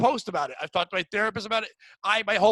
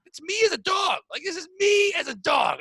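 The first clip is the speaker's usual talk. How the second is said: much higher, louder burst of delight; gleeful high exclamation; excited shout; 10 semitones higher, 3 dB louder than usual. Retold or imitated. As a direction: shout it out like a thrilled revelation. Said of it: "I'm like".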